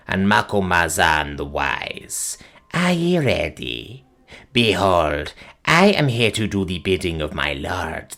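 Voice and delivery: low, slow, eerie crone voice; piping old witch. Acted. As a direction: croak it out like an ancient witch. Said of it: "she piped".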